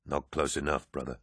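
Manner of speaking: quiet. A narrator's reading. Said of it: "Loken said".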